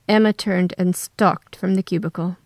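ordinary delivery, nothing marked

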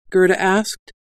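ordinary delivery

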